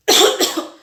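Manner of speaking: tos fuerte de demostración; tos fingida. Shown as a demonstration. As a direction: tose para mostrar. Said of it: "Sí, hacemos así". (tos de demostración)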